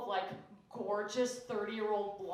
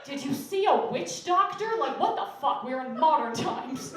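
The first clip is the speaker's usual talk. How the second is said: incredulous friend; 3 semitones higher, 6 dB louder than usual. Retold or imitated. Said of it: "she just goes".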